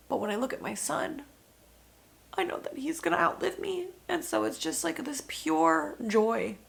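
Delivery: high, cracking tearful voice; crying. Real, not acted. Mother describing her son to the interviewer, crying. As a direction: cry through the words of joy. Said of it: "I know I'm crying".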